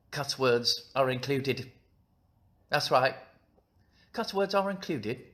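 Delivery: put-on, exaggerated British accent; hammed-up British accent. Shown as a demonstration. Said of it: "That's a British accent".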